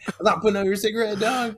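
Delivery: high, fast mock tough guy; imitated smoker dude. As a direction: toss it off like a defiant smoker. Smoker in steam room mocked.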